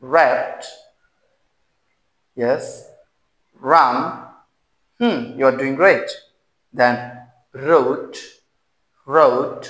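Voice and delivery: each word alone with a clear initial r and pauses for the class; repeat-after-me drill. Shown as a demonstration. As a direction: call out the words so learners can echo. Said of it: "repeat after me". (শিক্ষার্থীদের পুনরাবৃত্তির জন্য স্পষ্ট র দিয়ে বলা)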